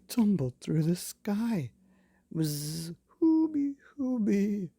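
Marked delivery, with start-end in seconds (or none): 2.3-4.7 high trumpeting elephant call; playful elephant noise; elephant cry. Acted. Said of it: "cried the elephant".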